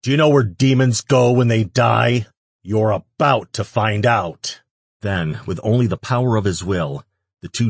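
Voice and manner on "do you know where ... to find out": menacing threat. A narrator's reading. Threat before executing demons.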